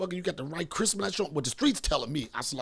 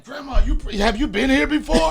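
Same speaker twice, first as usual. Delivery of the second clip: loud, high, laughing; laughing question; 9 semitones higher, 12 dB louder than usual. Retold or imitated. Comedian asking grandma amid laughter, loud laughing. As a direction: ask through laughter. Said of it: "I was like".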